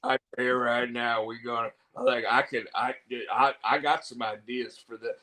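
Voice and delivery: lightly voiced show character, low and subdued; Chris Bauer impression. Host quotes an actor he believes, low character voice. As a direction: drop it low and halting, a hint of impression. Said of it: "he's like".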